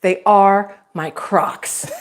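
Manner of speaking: put-on slow, deliberate, mildly indignant old lady; angry old lady. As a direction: spell it out word by word, put out at being asked. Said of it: "she's so, like, just angry at him".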